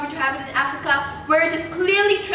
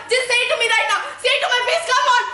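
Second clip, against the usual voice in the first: angry challenge; 8 semitones higher, faster than usual. Acted. Woman daring family to call her psychotic, high angry challenge. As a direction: goad them to say it to her face.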